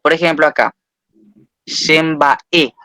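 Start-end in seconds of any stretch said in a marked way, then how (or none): 1.6-2.7 "Shembae" broken up, final E given most force; stress on final vowel. Shown as a demonstration. Teacher showing tonic accent, final E stressed in "Shembae". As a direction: land on the last E of "Shembae". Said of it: "la última vocal se pronuncia con mayor fuerza".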